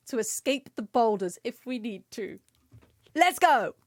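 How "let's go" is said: hype call. Real, not acted. Rallying chat for battle.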